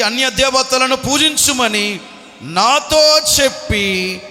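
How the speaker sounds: loud, high, impassioned scripture voice; fiery preaching. Acted. Preacher voicing David's plea to Saul, impassioned. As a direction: proclaim David's plea with full force.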